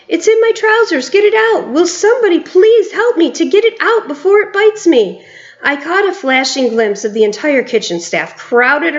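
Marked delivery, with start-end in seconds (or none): 0.1-5.2 high, loud desperate pleading; shouting. A narrator's reading. Stupid cook still shouting for help, high loud pleading. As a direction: beg everyone frantically for help. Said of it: "still shouting".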